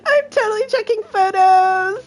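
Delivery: amused verdict broken by high bursts of laughter; real laughing. Real, not acted. Streamer praising Square Enix's finest hour, laughing. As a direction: keep giggling between sentences. Still laughing after the joke fight.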